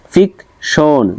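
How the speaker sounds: ফিকশন split as ফিক, শন; syllable by syllable. Shown as a demonstration. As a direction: say ফিকশন in two separate beats. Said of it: "দুইটা দেওয়া আছে".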